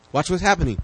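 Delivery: ordinary delivery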